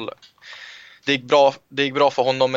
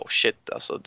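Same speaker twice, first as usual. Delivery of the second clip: whispered thought; 5 dB quieter than usual. Retold or imitated. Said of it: "Så tänkte jag".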